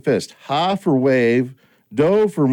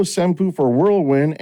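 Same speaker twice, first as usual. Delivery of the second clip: ordinary delivery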